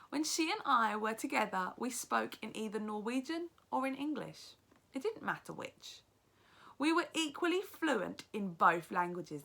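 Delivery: lively read-aloud narration, teacher to class; story narration. A narrator's reading. Teacher reads the novel aloud.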